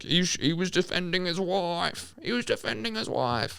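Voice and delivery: high mocking whine. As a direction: whine it like a naive fan. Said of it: "people out there who are like".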